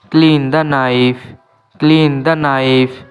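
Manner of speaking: slow, clear English for learners; careful dictation pace; slow teaching demo. Shown as a demonstration. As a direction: spell each word out slowly for students. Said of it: "এখানে কে silent থাকবে".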